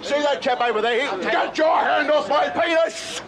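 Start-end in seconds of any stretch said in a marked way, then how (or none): 1.2-3.2 outraged shout. Real, not acted. Arrest, outraged yelling at cop.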